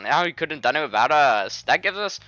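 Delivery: loud, lilting fake Indian accent; badly faked Indian accent. Friend excusing the jokes, in a hammed-up Indian accent. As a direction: tease in a broad put-on Indian accent. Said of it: "fucking Indian man's voice instead".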